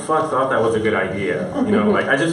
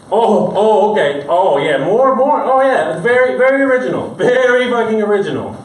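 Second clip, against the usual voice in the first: mock excited groan; 6 semitones higher, 4 dB louder than usual. Mock cop reacting to gunfire.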